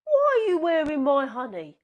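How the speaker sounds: high, loud accusing bear; loud put-out bear voice; indignant bear. Acted. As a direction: Douglas demands his honey back. Douglas questions sheep.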